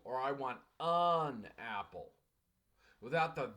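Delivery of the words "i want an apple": "an" stressed, slow "I want an apple". Shown as a demonstration. Teacher showing the correct article, slow with "an" stressed. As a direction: slowly lean on "an". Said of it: "in order to be correct".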